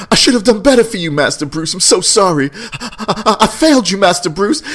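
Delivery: high, hammed-up sobbing butler; imitated blubbering. Butler apologizing at the grave, imitated blubbering. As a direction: blubber through a mock breakdown, stammering. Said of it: "he's crying and blubbering".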